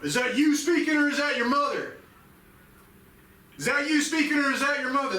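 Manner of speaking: loud, high shouted quote; father yelling accusation; angry father. Retold or imitated. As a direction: bark the question back at him. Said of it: "he looked at me and he said".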